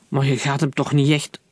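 plain reading voice

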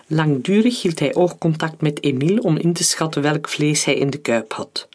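plain reading voice

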